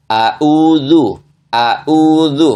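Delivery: a'udzu dengan ain dibaca seperti hamzah; ain jadi hamzah. Shown as a demonstration. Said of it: "dua-duanya dibaca dengan hamzah". (contoh salah, ain dibaca hamzah)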